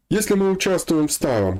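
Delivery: ordinary talk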